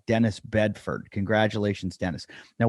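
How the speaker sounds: normal talking voice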